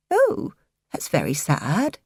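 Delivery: a lightly voiced disappointed old aunt; sad old aunt. A narrator's reading.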